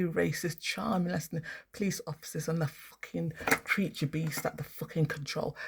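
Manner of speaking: usual speaking voice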